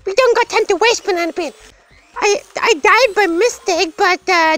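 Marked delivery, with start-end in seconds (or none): 0.0-1.0 high, fast playful panic; urgent hurry. Pretend. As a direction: rush it out in a high-pitched fluster. Urging haste in the game.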